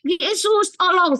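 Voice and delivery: high-pitched reenacted yell for help; retold cry for help. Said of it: "saya teriak". (menirukan teriakan minta tolong, bernada tinggi)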